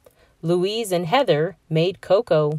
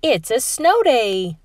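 put-on higher, slow cheer of a boy; excited yell; 6 semitones higher than usual. A narrator's reading. Robbie cheering to the family about snow, excited. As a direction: call out the news with joy. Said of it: "Robbie yelled".

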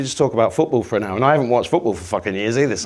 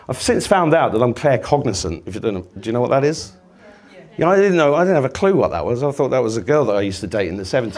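usual speaking voice

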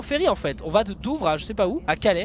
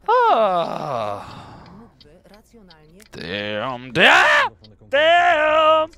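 dismayed damn; much slower than usual. Real, not acted. Crashing the truck in game.

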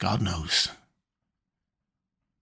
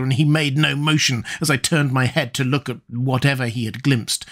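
ordinary delivery

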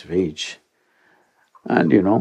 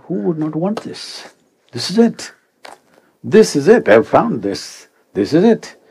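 higher, rising with elated wonder; ecstatic discovery; 7 semitones higher than usual. Retold or imitated. Twenty-five and ecstatic, he rejoices at his find. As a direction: relive the thrill of finding it.

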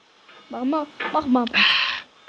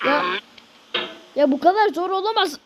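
frustrated outburst; 5 semitones higher than usual. Real, not acted. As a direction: vent at the game, pitched up. Frustrated at hard game.

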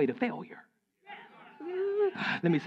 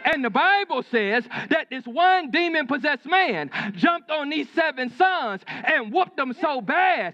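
high, forceful sermon delivery; preacher's raised, insistent pitch; emphatic preaching; 8 semitones higher, 4 dB louder than usual. Real, not acted. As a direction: drive the point home to the congregation.

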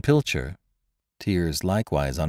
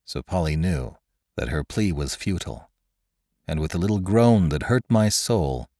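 usual speaking voice

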